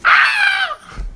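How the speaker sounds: high piercing scream of pain; sudden high shriek; child's scream. Retold or imitated. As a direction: scream like a hurt little boy. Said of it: "hears a scream".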